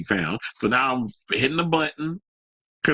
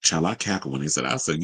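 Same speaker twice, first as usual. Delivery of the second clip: ordinary talk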